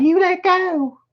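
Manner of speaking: very high squealed demand; crying out. Acted. Wife struggles as man hangs on, high panicked cry. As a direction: squeal for release in a woman's pitch. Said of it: "she cried".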